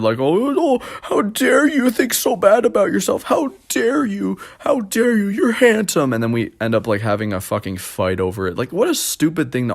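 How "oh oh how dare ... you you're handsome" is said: high, outraged mock mother; indignant mom. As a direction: scold him for putting himself down. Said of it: "And she said".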